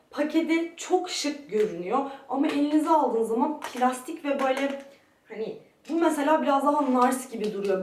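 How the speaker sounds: usual speaking voice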